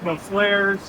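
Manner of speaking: normal talking voice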